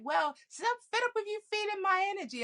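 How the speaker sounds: high, fast mock complaint; mocking mom. Mom complaining to daughter, high and fast mock whine. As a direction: rattle it off like a fed-up mother.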